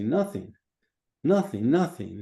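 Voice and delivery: firm client's reply, lifting in volume; convinced answer. Acted. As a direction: say it with growing conviction. Client now believes the answer.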